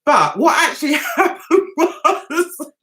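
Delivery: giggles breaking into the words; laughing while talking. Real, not acted. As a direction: struggle to get it out for laughing. Cracks up before the punchline.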